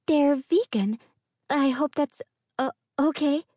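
high timid yes ma'am; meek and eager; timid girl voice. A narrator's reading. Inga speaks nervously.

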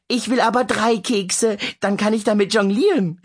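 put-on high, lively little girl's voice; eager child. Flummi calls to her mother, high eager child. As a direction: call out like a small kid. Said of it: "rief Flummi".

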